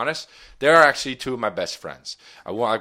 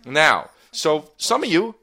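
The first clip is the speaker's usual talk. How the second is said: proud boast; 6 semitones higher, 3 dB louder than usual. Real, not acted. [name] boasts to listeners.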